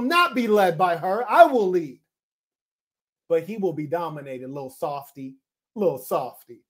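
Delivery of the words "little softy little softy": taunting coo. Retold or imitated. Taunting the crying man.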